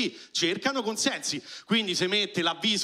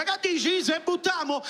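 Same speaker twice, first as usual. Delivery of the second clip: higher, cocky pick-up line; tomcat chatting up; 8 semitones higher than usual. Acted. Romeo the tomcat hitting on the rich cat, higher cocky voice. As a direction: toss it out like a smooth alley cat flirting. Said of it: "gliela appoggia e gli dice".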